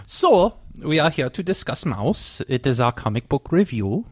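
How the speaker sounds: put-on comic German accent. Host introducing the comic, in a German accent. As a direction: present the review in mock German English. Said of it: "I start talking like this".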